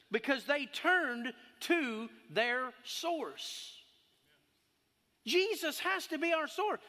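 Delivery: impassioned sermon voice; preaching. Acted. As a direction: preach it to the congregation. Preacher holds sermon delivery.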